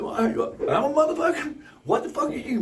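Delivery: high, loud cursing comeback; acted outrage, pitched high; angry retort. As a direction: fire back indignantly in the re-enacted quarrel.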